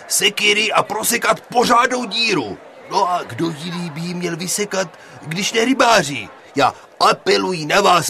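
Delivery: loud, high, pleading teacher's speech. Acted. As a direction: appeal loudly to the room, hesitating at the end. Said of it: "promlouval učitel Pěnička zvýšeným hlasem".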